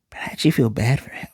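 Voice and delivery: a fast, breathy whisper to himself. Real, not acted. Viewer whispering his pity for the dying Quincy. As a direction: whisper it like a private confession.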